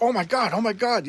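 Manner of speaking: high-pitched imitated fretting; lightly panicked, up high; mock alarm. Girls react to Prue freaking out, mock alarm.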